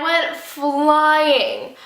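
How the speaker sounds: slow, stretched-out storytelling; drawn-out emphasis. Retold or imitated. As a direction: draw out the last word dramatically. Storytelling drags out the dramatic word.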